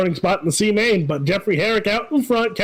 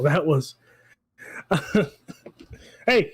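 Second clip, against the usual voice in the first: usual speaking voice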